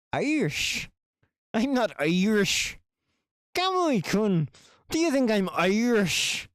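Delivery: high, loud put-on Irish lilt; indignant mock Irish voice; mock Irish accent. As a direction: protest in a hammed-up Irish accent. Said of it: "You want me to talk Irish?".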